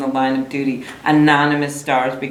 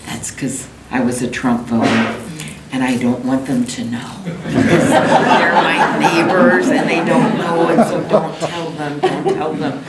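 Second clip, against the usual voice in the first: laughing. Retold or imitated. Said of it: "And he goes".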